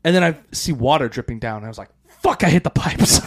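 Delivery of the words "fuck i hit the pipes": laughing curse. Retold or imitated. Realizing the shot hit pipes.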